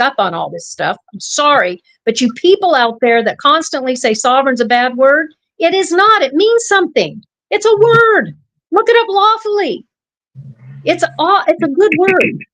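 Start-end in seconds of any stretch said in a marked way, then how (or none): 5.6-12.4 heated insistence. Real, not acted. Heated rant at critics.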